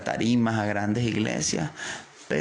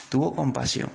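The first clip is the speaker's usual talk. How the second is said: ordinary delivery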